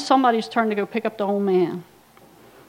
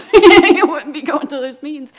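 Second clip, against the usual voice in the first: laughing through a mock reply to dad, burst louder; 3 semitones higher, 10 dB louder than usual. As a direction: laugh it out while teasing. Mocking dad's meetings.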